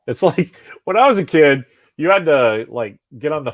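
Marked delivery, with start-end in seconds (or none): none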